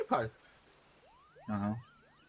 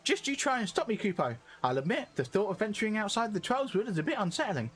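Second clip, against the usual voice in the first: higher, cheery little moogle; 8 semitones higher than usual. Acted. Streamer voicing the moogle, higher and cheery. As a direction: chirp it like a tiny moogle.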